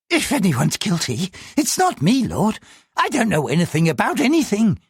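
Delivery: high, anxious pleading voice; a frightened man answering the police; nervous clerk. Acted. Nervous clerk answers the superintendent in a high, anxious voice.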